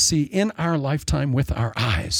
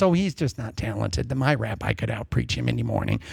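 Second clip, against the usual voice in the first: lower, quieter dismissive grumble; sniping critic; 7 semitones lower, 5 dB quieter than usual. Retold or imitated. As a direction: sneer at him under your breath. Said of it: "somebody that says to Jesus".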